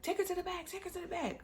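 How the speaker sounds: high-pitched hurried order, imitated; mimicked urgent staff. Hospital staff sending labouring woman back, mimicked urgency.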